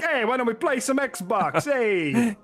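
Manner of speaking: mock Italian. Host jokes how he'd sound to friends, mock Italian. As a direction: sell it like a cheery Italian sandwich man. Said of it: "I'm like".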